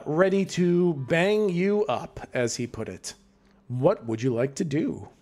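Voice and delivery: normal talking voice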